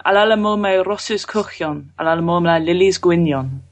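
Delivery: spoken in Welsh. Shown as a demonstration. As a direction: recite the Welsh lines. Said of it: "Do a bit of Welsh".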